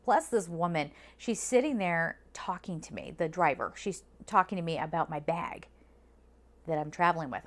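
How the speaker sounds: ordinary talk, nothing marked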